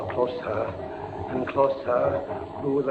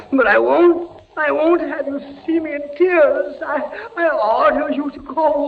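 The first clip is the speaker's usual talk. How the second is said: anguished refusal; 12 semitones higher, 3 dB louder than usual. Acted. Jean sends Beatrice away, anguished.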